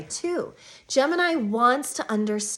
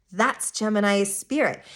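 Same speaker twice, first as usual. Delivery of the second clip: usual speaking voice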